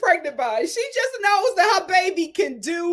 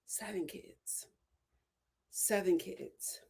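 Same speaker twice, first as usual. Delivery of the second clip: whisper; 7 semitones lower, 14 dB quieter, slower than usual. Real, not acted. Stunned by seven children.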